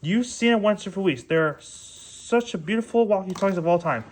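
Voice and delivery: ordinary talk, nothing marked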